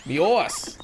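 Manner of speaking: high, hammed-up British accent; amateur Brit impression. Gamer trying out an unpractised Brit voice for fun, British accent. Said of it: "do my Brit, my Brit voice".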